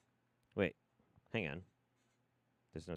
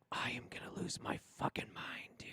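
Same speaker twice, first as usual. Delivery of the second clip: voiceless whisper at wits' end; hushed, near breaking point; exasperated whisper; 10 dB quieter than usual. Real, not acted. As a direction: whisper it through real frustration. Losing patience with broken audio.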